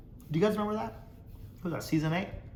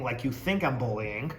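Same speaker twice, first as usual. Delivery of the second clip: ordinary talk, nothing marked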